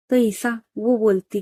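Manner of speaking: ordinary delivery, nothing marked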